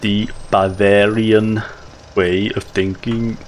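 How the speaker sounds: put-on slow, deliberate German accent, each word weighed; a playful Herzog with a German accent, taking his time; Werner Herzog impression. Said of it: "I don't know why the accent went shit".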